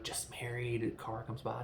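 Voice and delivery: ordinary talk, nothing marked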